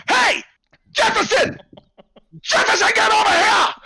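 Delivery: sudden high, loud yell across the room; ringleader calls out. Acted. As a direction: summon the helper with a showman's holler.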